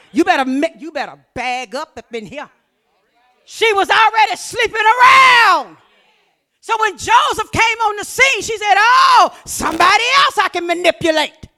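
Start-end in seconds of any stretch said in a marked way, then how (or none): 3.4-11.5 preaching at full force. Real, not acted. Preacher declaring to the church at full force. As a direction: drive it home to the church, full volume.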